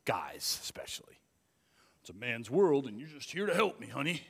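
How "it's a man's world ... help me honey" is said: smug husband voice, talking down; mocking chauvinist husband. Mocking a husband who tells his wife she only helps. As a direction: sneer it like a know-it-all husband. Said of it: "if you say it in that voice".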